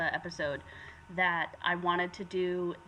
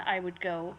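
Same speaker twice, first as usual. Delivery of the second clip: ordinary talk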